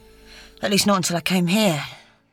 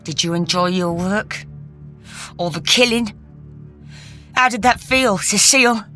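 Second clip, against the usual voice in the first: spat; 3 semitones higher than usual. A narrator's reading. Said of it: "he spat".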